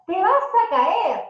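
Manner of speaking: put-on alarmed parent warning a toddler; sharp warning. Shown as a demonstration. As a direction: snap the warning at a child. Parent warning child harshly.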